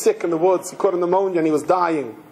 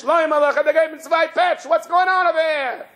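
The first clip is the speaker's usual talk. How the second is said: scolding mother; 9 semitones higher than usual. Retold or imitated. Mother comes knocking on Shlomo, retold scolding. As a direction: call it out high, imitating an indignant mother.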